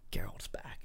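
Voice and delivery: hushed, breath-only whisper; awed whisper. Pretend. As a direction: whisper it like a long-awaited reveal. Imagined awed reaction to return.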